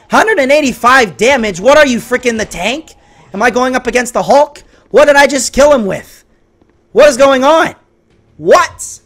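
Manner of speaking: high, loud disbelief; incredulous outburst. Real, not acted. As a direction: demand to know what just happened. Baffled by the low damage.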